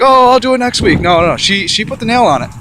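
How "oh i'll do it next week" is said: high, loud mock brush-off; lazy excuse. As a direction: toss off an excuse dismissively. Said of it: "I'm not gonna be like".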